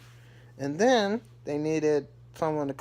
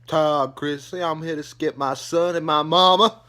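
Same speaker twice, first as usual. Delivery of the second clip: louder stern dad; Todd announcing; 3 semitones higher, 8 dB louder than usual. Retold or imitated. Todd announcing himself to the butler, stern. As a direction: announce yourself at the door. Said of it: "He's like".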